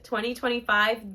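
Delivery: ordinary delivery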